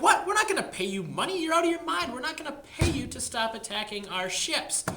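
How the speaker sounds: shocked, refusing to pay; outraged disbelief. Retold or imitated. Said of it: "the Americans are like".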